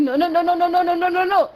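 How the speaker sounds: rapid-fire string of denials, evenly pitched; hurried retold denial. Imitating Axel backtracking after the smack, hurried denial. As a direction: rattle it off without a gap.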